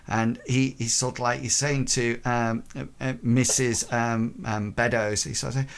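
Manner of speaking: normal talking voice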